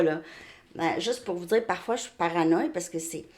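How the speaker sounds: ordinary delivery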